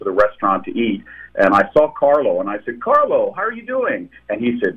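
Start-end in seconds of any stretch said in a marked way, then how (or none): 2.8-4.1 high-pitched, delighted hello; cheery greeting. Retold or imitated. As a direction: greet him brightly, pitched way up. Greeting an old acquaintance, retold.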